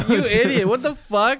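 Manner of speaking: high, laughing jeer at his friend; laughing disbelief. Real, not acted. Mocking a friend's dumb poem trick, laughing high.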